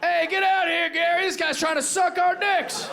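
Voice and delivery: high, loud holler to a buddy; alarmed calling out. Acted. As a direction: shout across the shop to raise the alarm. Local calls out to Gary, acted.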